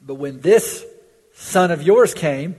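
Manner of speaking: put-on angry older brother shouting at his father; indignant son. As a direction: spit it out in resentment. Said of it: "You sense his indignance".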